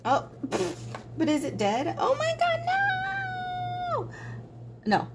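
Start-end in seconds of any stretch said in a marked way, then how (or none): 2.0-4.1 high, shrill cry of dismay; mock panic. Real, not acted. Artist finds her pen may be dead and shrieks in mock panic. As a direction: shriek as if disaster struck.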